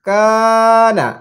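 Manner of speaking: very slow, long-held blend; drawn-out joined word. Shown as a demonstration. Teacher showing pupils how syllables join, very slow. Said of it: "যুক্ত করে করে উচ্চারণ".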